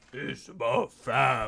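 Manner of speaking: slow, drawn-out tough-guy impression; Vin Diesel. Mocking the game character's talk of family, slow movie-star impression. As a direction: parody an action star, taking it slowly. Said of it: "Thank you, Vin Diesel".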